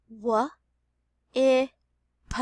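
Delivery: "W, I, p" as separate sounds; sounded out. Shown as a demonstration. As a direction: tap "W, I, p" sound by sound. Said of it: "Let's finger stretch whip".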